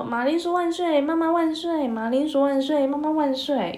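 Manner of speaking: cheering soldiers. Acted. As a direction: cheer with full bellies. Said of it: "心满意足大喊着说".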